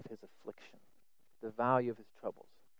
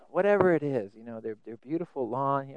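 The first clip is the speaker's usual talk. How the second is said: normal talking voice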